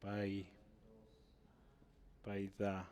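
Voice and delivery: "paidagogos" softly broken into syllables, with long pauses; quiet, halting dictation of "paidagogos"; syllable-by-syllable pronunciation. Shown as a demonstration. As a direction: sound out "paidagogos" low and piece by piece while writing it. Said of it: "o la pronunciación".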